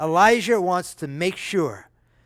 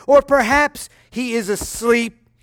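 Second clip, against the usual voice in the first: louder, higher teasing jab; mocking taunt; 5 semitones higher, 5 dB louder than usual. Elijah teasing Baal's prophets, loud playful mockery. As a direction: poke fun at the sleeping god. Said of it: "Elijah mocked them".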